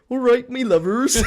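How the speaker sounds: high, loud put-on British regional greeting; put-on British regional accent. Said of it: "in an accent".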